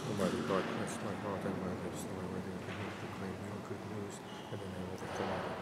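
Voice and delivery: hushed priestly prayer before the homily; quiet private prayer. Real, not acted. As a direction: pray it under your breath before preaching.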